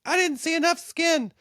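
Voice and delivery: very high put-on voice; mock complaining viewer. Viewer critiquing the podcast, high mock whine. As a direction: squeal it as a silly complaint.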